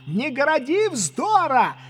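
Легран angry shouting. A narrator's reading. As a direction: scold and threaten from below. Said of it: "закричал Легран". (сердито кричит с угрозой)

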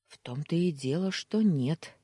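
plain reading voice

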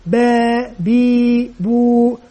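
با بي بو each vowel drawn out slowly; slow syllable demo. Shown as a demonstration. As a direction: stretch با بي بو for a small child. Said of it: "المعلم لا يقبل منه هذا". (نطق با بي بو ببطء شديد)

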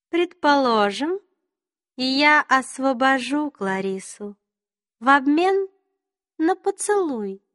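high, slow, teasing queen; high regal faerie queen. A narrator's reading. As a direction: sweetly toy with him. Queen voiced in the story.

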